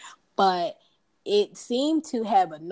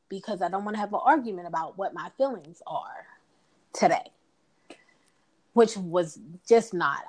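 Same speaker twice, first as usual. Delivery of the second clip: normal talking voice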